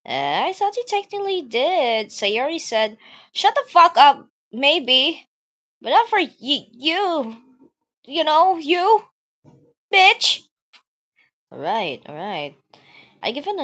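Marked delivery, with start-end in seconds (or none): 3.3-10.4 high, loud huffy yelling; angry tsundere. Acted. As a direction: snap at him. Natsuki rant, loud and high.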